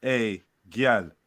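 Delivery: slow drawn-out. Acted. Slow drawn mock patois reply.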